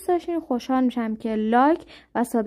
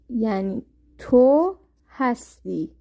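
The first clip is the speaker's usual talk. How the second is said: ordinary talk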